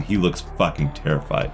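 ordinary delivery

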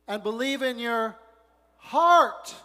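heart punched high and loud; stress on heart. Acted. Preacher driving the word heart home to the congregation, stressed. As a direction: hit heart hard.

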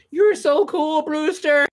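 very high-pitched movie line impression; Stephen Jeffries impression. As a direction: quote the film line in a put-on high voice. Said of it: "Stephen Jeffries, of course, with".

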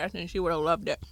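talking around a sip of drink; mid-sip, words half in the cup; while sipping a drink. Real, not acted. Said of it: "Sorry, I'm drinking".

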